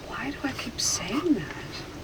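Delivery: whispered, bewildered question to oneself; baffled whisper. Real, not acted. Subject wondering at their own blurted words, baffled whisper. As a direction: whisper it in real confusion.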